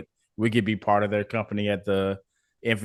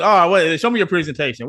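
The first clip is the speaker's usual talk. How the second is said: mock-eager client, raised and louder; higher, louder acted-out demand; eager request; 8 semitones higher, 7 dB louder than usual. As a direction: imitate someone keen to see more.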